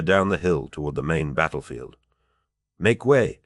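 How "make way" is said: a raised call, short of a real scream; half-shouted clearing of the road; mock scream. A narrator's reading. De Verck clearing soldiers from the path, half-shouting. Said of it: "de Verck screamed".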